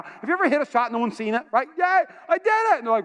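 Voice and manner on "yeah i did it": high, jubilant boast; excited celebration. Retold or imitated. Golfer boasting of his shot, excited. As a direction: crow about your win in a raised pitch. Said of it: "I'm excited about that shot".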